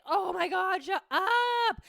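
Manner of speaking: high, drawn-out exasperated plea. Acted. Exasperated retort to her loud brain.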